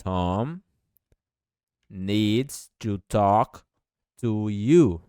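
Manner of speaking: very slow, words pulled apart; slow dictation. Shown as a demonstration. Teacher dictating slowly to Portuguese-speaking students. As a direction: lay each word down slowly. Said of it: "Perceba o S".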